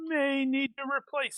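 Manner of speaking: high-pitched, hedging sailor voice; acted sailor. Sailor stalling the departure to the captain, acted doubt. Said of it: "to discover something that's gonna delay".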